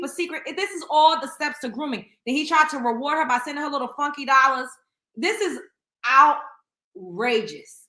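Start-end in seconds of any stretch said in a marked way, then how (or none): 5.1-7.8 drawn-out outrage. Real, not acted. Stunned by father's texts.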